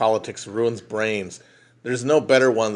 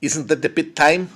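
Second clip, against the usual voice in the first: put-on South African accent; 6 semitones higher than usual. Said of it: "South African accent".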